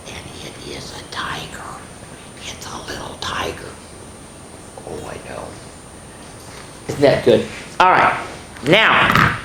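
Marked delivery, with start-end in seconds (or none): 0.1-5.4 hushed whisper of a secret. Acted. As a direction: whisper the surprise to the kids. Secret reveal to kids.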